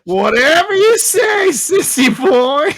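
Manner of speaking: put-on high, loud, scolding old-woman voice; shrill-free but shouted grandma rebuke; nagging grandma. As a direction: bark at him like a mean granny.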